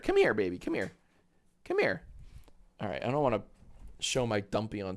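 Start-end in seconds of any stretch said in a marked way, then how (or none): 1.6-2.0 high coaxing call; high pet voice. Real, not acted. Calling the dog.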